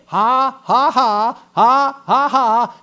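loud, forced taunting laugh; laughing at the devil. Acted. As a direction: hammer out separate mocking "ha"s. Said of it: "I'm laughing at you".

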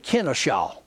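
"Kennesaw", stressed at front and back, not the middle; own mispronunciation. Shown as a demonstration. Preacher owning up to his road name, mispronounced KIN-a-shaw. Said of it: "I kept calling that road".

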